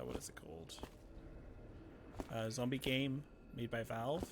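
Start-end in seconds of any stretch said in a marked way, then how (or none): 0.1-0.8 whispered aside to himself; whispering. Real, not acted. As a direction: breathe the question under his breath. Muttering to himself mid-thought.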